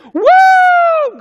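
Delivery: high, loud joyful woo; exultant whoop. Real, not acted. Preacher whooping for joy at the congregation. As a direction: let out a rejoicing whoop.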